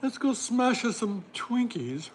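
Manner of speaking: high, slow mock-British voice; hammed-up British, pitched well up; attempted British accent. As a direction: put on a playful, bad British accent. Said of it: "I can't do my very British accent".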